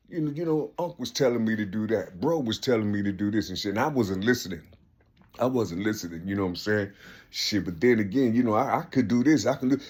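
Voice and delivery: low, quiet talking to himself; muttered inner thoughts. Acted. Young man reasoning why he ignored advice, low mutter. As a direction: drop down low and soft, as if thinking privately.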